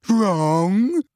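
high, loud, trembling cry; wounded outrage rising; throbbing with emotion. A narrator's reading. Trelawney crying out to Parvati, high and trembling. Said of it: "in a voice throbbing with emotion".

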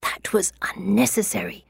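sharp hissed whisper. A narrator's reading. Embarrassed girl to the friend who volunteered her, hissed whisper. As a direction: hiss it under your breath. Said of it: "I hiss".

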